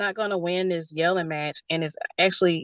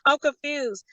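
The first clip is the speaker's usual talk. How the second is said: mock confused child; 7 semitones higher than usual. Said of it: "it's like".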